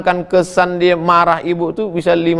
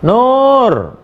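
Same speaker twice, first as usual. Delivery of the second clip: mother calling her daughter by name, raised; a mock mother's call across the house; 8 semitones higher, 3 dB louder than usual. Mother calls daughter during prayer, raised call.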